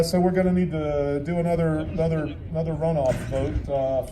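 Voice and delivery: ordinary talk, nothing marked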